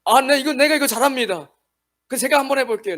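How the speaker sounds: boastful volunteer. Retold or imitated. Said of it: "이건 전혀 성경적이지 않아요".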